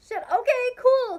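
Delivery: high, loud squeal of disbelief; blurting it out, thrilled and shocked; excited squeal. Retold or imitated. Told she can go up, squealing high and loud. Stunned reaction at bouncer letting her in.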